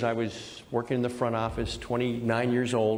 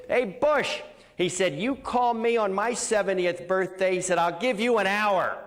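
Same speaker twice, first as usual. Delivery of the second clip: retold yelling; 7 semitones higher than usual. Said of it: "Ted started screaming at me".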